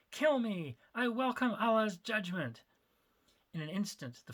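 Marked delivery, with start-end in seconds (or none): 0.1-2.6 defiant scream. Acted. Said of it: "he screamed out".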